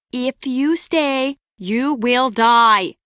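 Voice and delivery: drawn-out, deliberate threat; very slow and emphatic; slow warning. Acted. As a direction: spell it out word by word. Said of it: "I'll say it slow".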